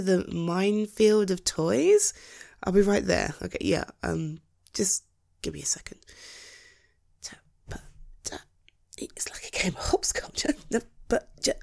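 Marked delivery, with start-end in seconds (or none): 4.7-11.5 quick whispered aside to himself. Acted. Husband picking through the toy minefield, whispering to himself. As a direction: whisper it while stepping over toys.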